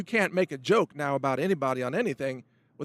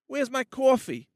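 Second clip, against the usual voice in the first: higher, slow New York caricature; New York accent; 8 semitones higher than usual. Shown as a demonstration. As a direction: do a rough attempt at a New Yorker, drawn out. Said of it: "You could do a New Yorker thing".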